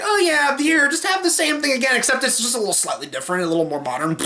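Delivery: starts high, tumbles down quickly; high-pitched, dismissive mock salesman; mocking sequel pitch. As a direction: imitate a lazy producer shrugging it through.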